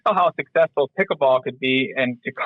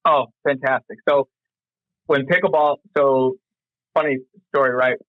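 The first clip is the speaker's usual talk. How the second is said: ordinary talk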